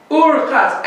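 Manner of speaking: "Ur" called out high and loud; stress on Ur. Shown as a demonstration. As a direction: hit "Ur" in "Ur Chatz" to show the link. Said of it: "and means it connects it".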